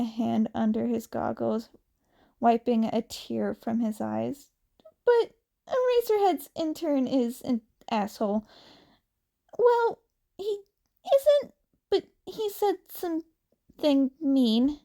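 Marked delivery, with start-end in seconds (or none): 5.0-14.8 put-on teary teen sulking about a bully; Izuku voice. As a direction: whine it out, hurt and indignant. Izuku complains about intern.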